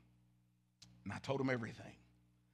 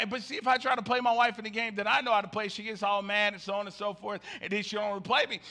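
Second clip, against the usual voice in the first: ordinary delivery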